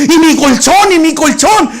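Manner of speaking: high, loud alarmed cry; panicked beggar. Acted. Beggar asking the youths for his old mattress, high and alarmed. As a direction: plead for the lost mattress.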